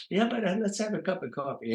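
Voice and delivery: a hint of the manager's way of talking; Harry Colombi's voice. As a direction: toss off the invite in the manager's voice. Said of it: "he had this kind of a thing about talking like this".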